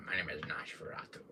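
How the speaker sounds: eerie whispered introduction; Nosferatu whisper. Acted. Streamer introducing his Nosferatu figure in a creepy whisper. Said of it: "I never showed Nosferatu".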